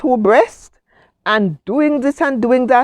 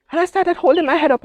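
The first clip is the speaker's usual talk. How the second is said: normal talking voice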